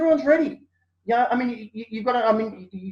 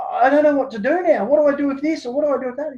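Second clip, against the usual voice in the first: higher, worried pleading; agitated officer; 9 semitones higher, 5 dB louder than usual. Retold or imitated. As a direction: voice him getting all worked up and lost. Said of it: "he's getting all agitated".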